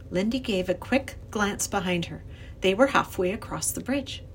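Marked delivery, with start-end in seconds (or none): none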